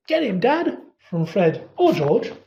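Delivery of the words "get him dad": high yelled cheer; egging on a brawl, much higher. A narrator's reading. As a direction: twin yelling for his father to win a fight. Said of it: "There was a yell".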